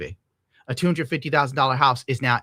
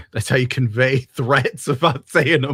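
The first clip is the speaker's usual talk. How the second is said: laughing; 6 dB louder than usual. Real, not acted. Laughing at Italian hand talk joke.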